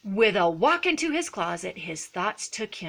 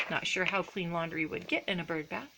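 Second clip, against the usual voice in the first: usual speaking voice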